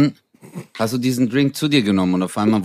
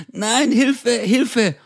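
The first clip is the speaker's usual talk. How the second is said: much higher hammed-up panicked call; higher mock panic; mock cry for help; 13 semitones higher, much slower than usual. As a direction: yell for help in mock terror.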